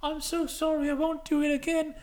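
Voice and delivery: high, put-on apologetic voice; Amanda Holden. Player apologising to the Australian Prime Minister, high mock pleading. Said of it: "And Amanda Holden goes".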